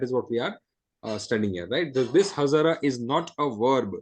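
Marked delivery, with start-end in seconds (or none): none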